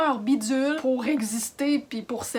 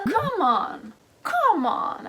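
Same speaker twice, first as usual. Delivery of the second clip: put-on English accent; 5 semitones higher, much slower than usual. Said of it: "Avec l'accent anglais".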